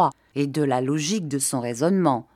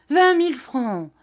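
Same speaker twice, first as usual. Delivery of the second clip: "vingt mille francs" jumping much higher, shrieking falling end; "vingt mille francs" repeated far higher with a falling end; outraged repeat; 9 semitones higher, 4 dB louder than usual. A narrator's reading. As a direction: pitch the repeat way up in disbelief. Repeats the sum twice, rising.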